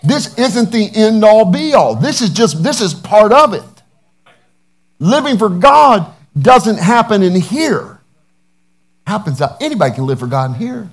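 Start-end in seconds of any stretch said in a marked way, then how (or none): 0.0-8.0 loud, slow preaching climax. Acted. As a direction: build the sermon to full force. Preaching at full force.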